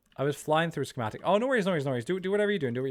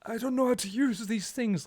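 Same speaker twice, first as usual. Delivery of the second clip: high-pitched put-on old man voice; mock old man; 10 semitones higher than usual. Teasing Ali as an internet boomer, mock old man. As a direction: play a helpless old man baffled by tech. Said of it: "mind of an old man when it comes to internet".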